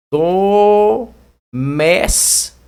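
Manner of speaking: very slow "doméstico", DO and MÉS drawn out; syllable by syllable. Shown as a demonstration. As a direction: stretch each syllable of "doméstico" apart. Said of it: "sílaba tônica". (sílabas longas e separadas)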